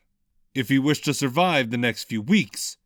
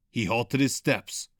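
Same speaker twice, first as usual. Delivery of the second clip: usual speaking voice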